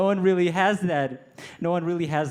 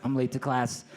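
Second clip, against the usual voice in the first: bored shrug; 7 semitones lower, 5 dB quieter than usual. Retold or imitated. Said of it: "I'm sitting in the back like".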